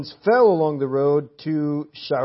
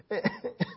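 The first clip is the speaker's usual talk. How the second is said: laugh; 3 semitones higher, 9 dB quieter than usual. Real, not acted. Laughs after his own shouting.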